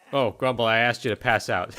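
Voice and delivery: usual speaking voice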